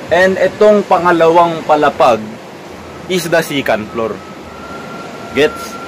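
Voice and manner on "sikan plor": "second floor" as "sikan plor", Philippine style; Philippine accent. Shown as a demonstration. Vlogger labelling the upper storey, Philippine accent. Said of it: "kung mahal mo ang Philippine accent".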